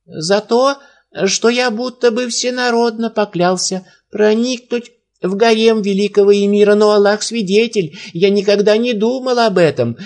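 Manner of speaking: put-on high, pleading old man's voice. A narrator's reading. Old sage pleading with the emir, high and anxious.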